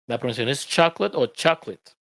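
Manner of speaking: usual speaking voice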